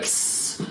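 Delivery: soft imitated hiss; a quick animal hiss, no voice; squirrel hiss. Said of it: "the squirrel was like".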